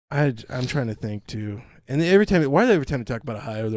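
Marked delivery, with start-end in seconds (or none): none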